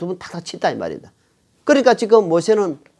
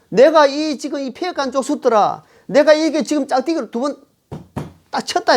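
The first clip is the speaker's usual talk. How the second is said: higher-pitched, mildly angry scolding; imitated anger; 6 semitones higher than usual. Moses scolding the rebellious people as he strikes the rock, acted anger. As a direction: play an exasperated prophet losing his temper. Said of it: "모세가 분이 찼다 이 말입니다".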